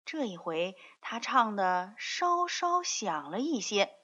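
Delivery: usual speaking voice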